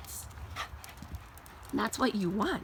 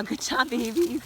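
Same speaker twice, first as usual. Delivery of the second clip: slow fond praise; 3 semitones higher, 6 dB louder, slower than usual. Real, not acted. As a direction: draw out the praise lovingly. Praising dogs going in.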